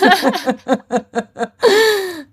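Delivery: high-pitched peal of laughter; long, high real laugh. Real, not acted. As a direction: laugh hard at the joke. Laughing after Lifetime movies joke.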